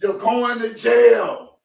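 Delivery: slow, high shouted verdict; mock-stern Levi, drawn out. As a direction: bellow it like Levi passing sentence. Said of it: "In my Levi voice".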